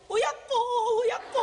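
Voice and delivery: mock Gyeongsang dialect wailing; a light Gyeongsang dialect cry of woe; 경상도 사투리로 통곡하듯. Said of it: "경상도 사투리에 경상도 사투리".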